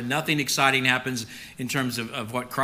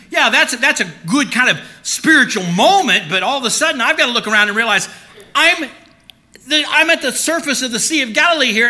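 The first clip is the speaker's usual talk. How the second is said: rising alarm from delight to panic, pitched up and loud; panicking Peter; 9 semitones higher, 7 dB louder than usual. Acted. Preacher voicing Peter's rising panic on the water. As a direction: Peter realizing he is sinking.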